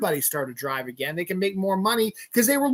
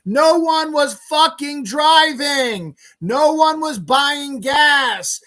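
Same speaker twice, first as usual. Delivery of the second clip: high, slow, loud shout; exasperated yelling; 7 semitones higher, 6 dB louder, slower than usual. Real, not acted. Ranter explaining pandemic gas prices to fools, yelling. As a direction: hammer the point home at full volume.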